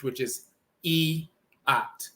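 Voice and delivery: e at said slowly as separate sounds; e at, each part on its own; slow spelled sounds. Shown as a demonstration. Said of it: "it's I A H".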